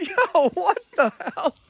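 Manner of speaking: high, laughing disbelief; slow the last words in amused shock; incredulous. Retold or imitated. Boss retelling his reaction at the hearing, incredulous.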